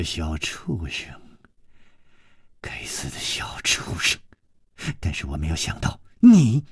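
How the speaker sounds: low, hissed, hateful; a mother hissing hatred at the intruder; venomous whisper. Acted. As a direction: spit the insult under her breath. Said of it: "冷笑着说".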